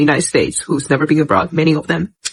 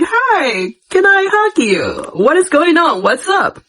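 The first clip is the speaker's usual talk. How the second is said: eager Chinese greeter; 9 semitones higher, slower than usual. Retold or imitated. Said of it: "They were like".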